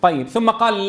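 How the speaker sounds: ordinary delivery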